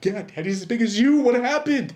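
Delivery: high, loud amazed voice; astonished kid. Retold or imitated. Kid telling dad about his huge rival. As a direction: marvel at how big he got.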